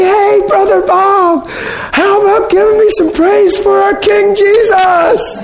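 high, slow, loud mock shout; booming hammed-up holler; real loud call-out. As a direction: put the stranger on the spot, loudly. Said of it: "And he'd say it real loud".

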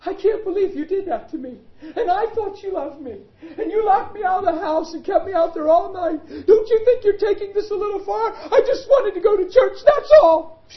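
high, loud, whiny mock complaint; hammed-up wounded wife wailing at her husband; indignant wife. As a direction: mimic the offended wife shrilly.

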